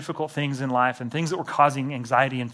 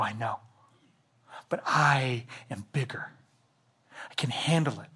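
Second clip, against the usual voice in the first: gently, you are bigger; soft reassurance; 3 semitones lower, 6 dB quieter than usual. Retold or imitated. God calming a believer with a huge worry, softly.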